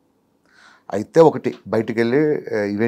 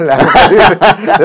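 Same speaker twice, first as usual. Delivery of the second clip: laughing; 6 semitones higher, 10 dB louder, much slower than usual. Real, not acted. Laughing through a tease.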